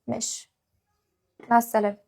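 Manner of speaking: soft, clipped okay; quiet resigned okay; curt goodbye. Acted. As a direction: drop it almost under your breath. Coldly ending the phone call.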